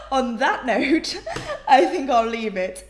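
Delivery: loud, drawn-out words through laughter; laughing. Real, not acted. Vlogger wrapping up after a compliment, real laughing delivery.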